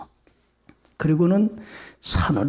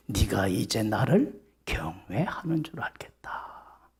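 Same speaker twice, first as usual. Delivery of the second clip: slow, whispered; soft whisper; 5 semitones lower, 7 dB quieter, slower than usual. Acted. God approving Abraham's reverence, slow whisper. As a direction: tell him softly, in a whisper. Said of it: "하나님이 그래".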